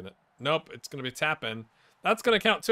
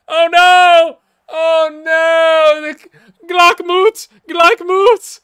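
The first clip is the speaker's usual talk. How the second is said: much higher and louder, stretched slowly; anguished cry; 17 dB louder, much slower than usual. Real, not acted. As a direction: wail the word out in despair. Cries as chance lost.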